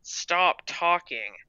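slow order through gritted teeth. A narrator's reading. Harriet silences Gertrude before the teacher, gritted teeth. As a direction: force each word out through clenched teeth. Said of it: "Harriet said through gritted teeth".

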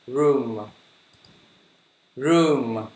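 "Room" with a long, tightly rounded oo; lips fully pouted. Shown as a demonstration. Teacher shows learners the mouth shape, "Room" with lips pushed right out. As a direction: pout hard through the vowel of "Room". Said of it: "chu miệng hết cỡ nha".

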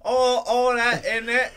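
hammed-up British geezer, pushed up high; mock British accent. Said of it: "you will have a British accent".

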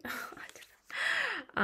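giggly breathy whisper, voice jumping up; laughing whisper. Real, not acted. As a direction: laugh it off in a hushed squeak. Giggling uncertainty about bouncy skin.

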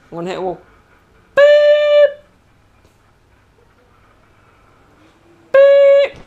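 loud, high imitated truck beeps; be a reversing lorry alarm; loud high mock reverse beeper. Shown as a demonstration. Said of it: "I need reverse beeping".

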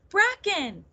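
high-pitched falling call; crying out. A narrator's reading. Nettle calls to her cousin while flying down, crying out. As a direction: cry the name out, high then dropping. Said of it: "she cried".

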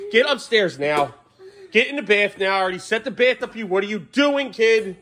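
high, exasperated parental telling-off; worked-up parent giving urgent orders. Real, not acted. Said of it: "I have to raise my voice".

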